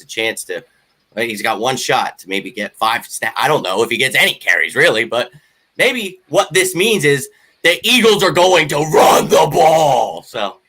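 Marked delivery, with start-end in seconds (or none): 5.8-10.2 slow, loud, high excited shout; fired up. Real, not acted. Podcaster hyped about the run game, shouting excitedly. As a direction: belt out the hope that they run it. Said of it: "Well, [name]'s awake".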